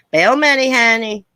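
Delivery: high, loud catchphrase impression; Miss Effin' Wonderful. Streamer imitating an online personality for her chat, high and loud. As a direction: belt it out as a mimicked online personality. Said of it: "Be like Miss Effin' Wonderful".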